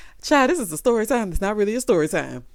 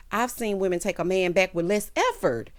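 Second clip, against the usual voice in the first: ordinary talk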